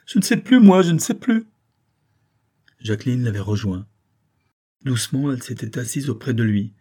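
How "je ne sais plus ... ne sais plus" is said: high, loud wail of confusion; distraught cry. A narrator's reading. Banker collapsed in an armchair, distraught outcry. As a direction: cry out helplessly, at wit's end. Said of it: "il s'écria en comprimant son front".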